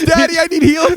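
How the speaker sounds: put-on high, crying little-kid plea; whining kid. Son begging his healer dad in-game, high whiny plea. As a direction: beg like a small boy for help. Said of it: "He goes".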